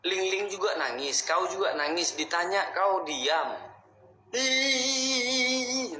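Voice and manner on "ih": high drawn-out mock wail; imitated crying. Man mimicking the ghost who cries when asked, mock crying. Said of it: "nangis, nangis, nangis".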